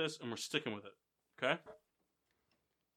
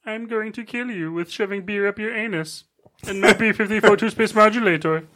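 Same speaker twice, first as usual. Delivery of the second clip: Marvin the Martian impression; 8 dB louder than usual. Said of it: "Can you do them again for me?".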